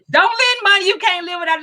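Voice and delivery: high, laughing through the rule; laughing repeat. Real, not acted. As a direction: say it again with a laugh.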